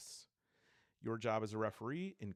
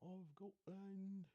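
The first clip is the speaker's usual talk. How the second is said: a second soft, slow, high stab at a Scottish accent; attempted Scottish accent; 10 semitones higher, 9 dB quieter, much slower than usual. Shown as a demonstration. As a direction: drawl "Of Gotland." in a failed Scottish accent. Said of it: "No, that's not".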